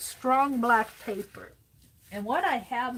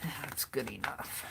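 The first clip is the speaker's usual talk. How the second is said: whispered aside to herself while pinning; 8 semitones lower, 10 dB quieter than usual. Real, not acted. Crafter muttering to herself at the desk, whispering. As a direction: breathe it out under her breath.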